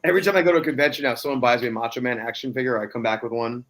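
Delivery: ordinary talk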